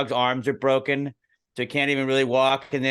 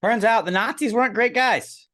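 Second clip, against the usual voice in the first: high, mock-innocent irony; deadpan it in a higher voice; mock surprise; sarcastic understatement; 10 semitones higher, 3 dB louder than usual.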